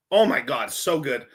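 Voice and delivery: ordinary delivery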